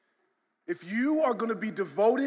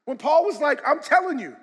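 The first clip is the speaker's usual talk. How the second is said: loud, high preaching to the congregation; preacher warning the church that persecution is coming, shouting; full-force preaching; 6 semitones higher, 5 dB louder than usual. Acted.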